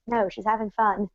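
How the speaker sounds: low, soft, understated reply; attempted Garnet impression. Answering Pearl's plea to stop her, lightly attempted impression. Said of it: "Garnet would be like".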